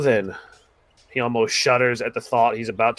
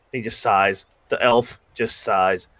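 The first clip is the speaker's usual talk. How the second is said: normal talking voice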